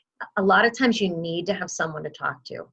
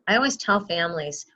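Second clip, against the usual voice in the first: ordinary talk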